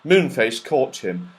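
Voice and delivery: usual speaking voice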